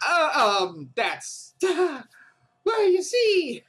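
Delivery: high, giggly stalling; flustered inventor. Acted. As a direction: stammer as if caught lying. Yui caught out.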